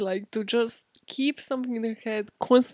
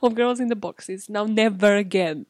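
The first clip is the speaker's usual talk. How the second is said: ordinary delivery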